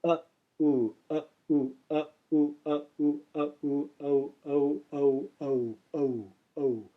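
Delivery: uh sliding into oo, blending to oh; uh plus oo glide. Shown as a demonstration. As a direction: alternate uh and ooh until they merge into oh. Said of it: "So uh plus oo".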